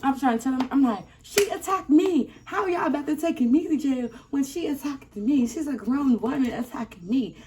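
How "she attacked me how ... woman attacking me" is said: high, loud retold pleading; reenacted indignant complaint; mock outraged protest. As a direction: argue your innocence, voice raised. Protesting arrest to police, reenacted.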